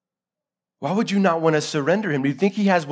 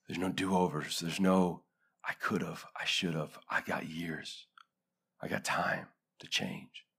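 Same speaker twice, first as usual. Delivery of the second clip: very low and soft, long pauses; hushed, grave preaching; 9 semitones lower, 8 dB quieter than usual. Acted. Preacher warns we get one shot at this, hushed and grave. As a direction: let it sink almost to nothing, solemnly.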